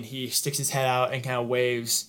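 ordinary delivery

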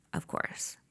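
sarcastic aside. Real, not acted. Bitter aside.

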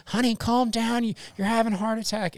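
high, fast mock wife voice; pleading wife. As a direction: plead with him like a worried wife. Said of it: "The wife's like".

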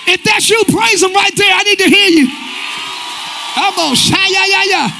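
high, shouted declaration; rally the congregation with ringing repeated declarations; full-force preaching. Real, not acted. Preacher declaring blessing on future generations, full-force preaching.

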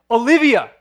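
put-on loud alarmed shout of a child's name; yell. Father yelling at his daughter about to run into the street, loud shout. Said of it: "I yelled her name".

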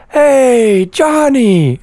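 calling out. Retold or imitated. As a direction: shout a cheerful hello. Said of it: "yells at me".

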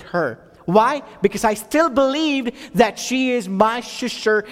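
slowed, building preacher's cadence; earnest sermon build; preaching. Acted. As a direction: preach it, leaning on each phrase. Full preaching close of testimony.

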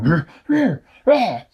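high, loud monkey roars; monkey growl. A narrator's reading. Angry monkey trying to reach its back. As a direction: snarl and squeal like a big ape. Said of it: "was going".

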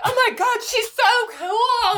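very high, drawn-out admiration; squealed, slow, hammed-up awe; mock gushing. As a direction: imitate starstruck fans fawning. Imitating impressed Californians gushing.

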